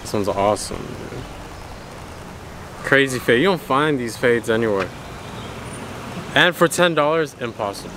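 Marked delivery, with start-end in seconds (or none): none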